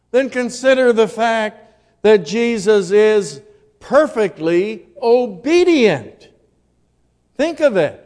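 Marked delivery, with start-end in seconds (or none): none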